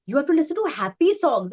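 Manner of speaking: a hint of a mum laying down the rule; insistent mother. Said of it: "she's like".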